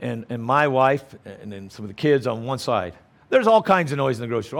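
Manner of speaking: normal talking voice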